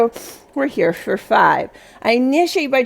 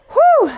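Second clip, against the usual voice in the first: whew exhale; 5 dB louder than usual. Real, not acted. Exhale after finishing the set.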